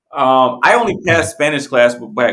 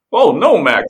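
lightly attempted cartoon dog voice; Goofy; 3 semitones higher, 3 dB louder, slower than usual. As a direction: take a rough stab at a cartoon voice. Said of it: "how would you do goofy".

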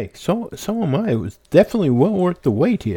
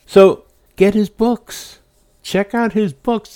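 enthusiastic plug; 6 semitones higher, 4 dB louder than usual. Real, not acted. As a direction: get his books, pitched higher and louder. Host urging listeners to buy.

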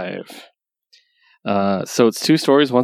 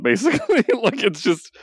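laughing; 11 semitones higher than usual. Real, not acted. Laughing at own story.

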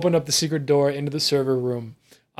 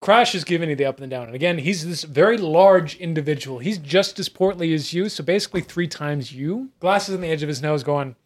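ordinary talk

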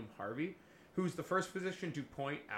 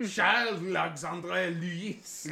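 slow, drawn-out French name, louder; grandly stretched French accent; mock French accent; 3 semitones higher, 5 dB louder, much slower than usual. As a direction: roll the name out in hammed-up French. Mocking the Frenchman's long name.

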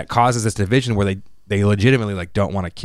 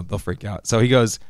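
ordinary talk, nothing marked

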